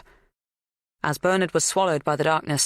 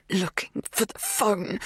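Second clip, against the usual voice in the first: dry throat; 4 semitones higher, slower than usual. A narrator's reading. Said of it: "scrape some words past the dryness in my throat".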